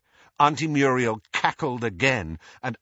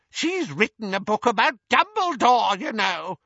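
put-on high, shrill old lady; high-pitched elderly busybody; gossiping old aunt; 13 semitones higher, 4 dB louder than usual. A narrator's reading. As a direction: gossip loudly in a piercing old voice. Narrator voices Auntie Muriel.

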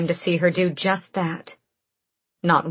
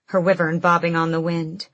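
usual speaking voice

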